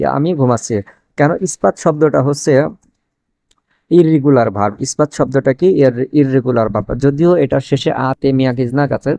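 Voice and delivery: normal talking voice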